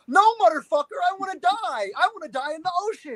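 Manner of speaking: high, fast mock whine of a drowning man; mocking Jack. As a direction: squeal it out like a dramatic movie death. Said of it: "and you're like".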